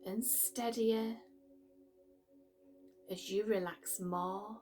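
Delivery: calm, unhurried meditation voice with long pauses; guided meditation. Acted. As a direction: gently guide the listener into relaxation, pausing between phrases.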